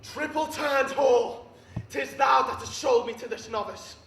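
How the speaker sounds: loud, projected tragic rage; full-force stage fury; furious declamation. Acted. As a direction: roar it out as betrayed Antony. Acted rage at betrayal in monologue.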